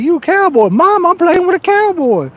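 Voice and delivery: mock an amazed kid calling out to mom; imitating kids. Kid tells mom about the cowboy teammate, imitated high. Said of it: "Them kids are like".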